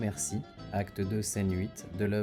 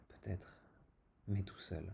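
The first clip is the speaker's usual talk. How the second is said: hushed, softer stage whisper; 11 dB quieter than usual. Acted. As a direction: drop to a whisper for the ending. Tirade ends on a hush.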